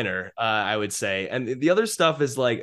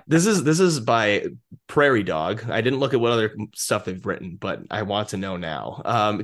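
normal talking voice

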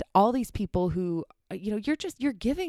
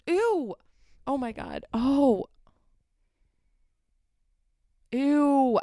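disgusted ew; 6 semitones higher, much slower than usual. Real, not acted. Reacting to the neighbor's cooking smell with disgust. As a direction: recoil from a stink.

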